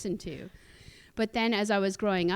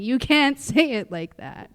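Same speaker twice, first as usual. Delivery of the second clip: stern correction; 6 semitones higher, 8 dB louder, slower than usual. Retold or imitated.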